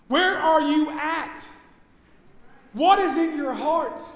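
loud, driving pulpit delivery; preacher stirring the congregation; full-force preaching. Acted. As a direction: preach it out with conviction.

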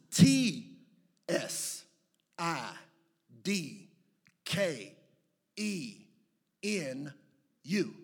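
letter by letter with clear pauses; spelling it out. Shown as a demonstration. Preacher helping the congregation spell a hard name, letter by letter. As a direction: spell slowly, one letter at a time. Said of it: "I'll help you spell it".

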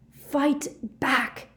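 high, strained yell; screamed challenge. A narrator's reading. Harry goading Snape to duel, screaming. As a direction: scream it as a desperate dare. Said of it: "Harry screamed at him".

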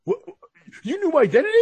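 stutter, then yelp in mock disbelief; hammed-up high-pitched shock; shocked sidekick.

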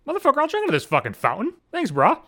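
eager cat's thoughts in a high voice; high-pitched put-on pet voice; Finley. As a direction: voice a pet gladly taking the offer. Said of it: "Finley saw the fountain and he thought".

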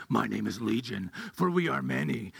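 low, menacing demon voice; scary movie demon. Retold or imitated. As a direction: sound like the demon in a horror film. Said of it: "They try to make him sound really scary".

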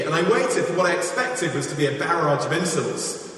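usual speaking voice

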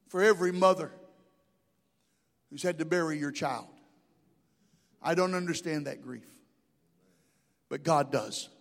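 quiet, tender comfort; soft consoling. Real, not acted. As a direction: speak gently to the grieving. Comforting grieving mothers.

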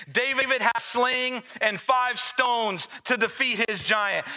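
high, rising full-force preaching. Acted. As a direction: preach it out with building fire.

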